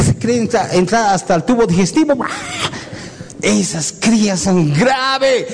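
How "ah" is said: chillido agudo de cría, muy suave y sin aire; imitated chick squawk. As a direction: graznido agudo de pichón que pide comida. Imita a las crías con el pico abierto. (los pichones abren el pico: chillido muy agudo y débil)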